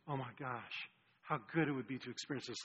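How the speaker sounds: soft, low murmur of wonder; hushed awe. Retold or imitated. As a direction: voice a listener's quiet longing, low and soft. Voices listeners' hushed wonder at verses.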